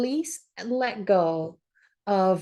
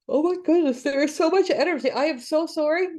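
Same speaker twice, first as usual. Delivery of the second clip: higher, stretched words pushed out mid-yawn; talking through a yawn; 8 semitones higher than usual. Real, not acted. Reader yawns mid-reading while apologising to viewers, talking through a yawn. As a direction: let the yawn carry the words. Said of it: "I'm not yawning. I'm releasing energy".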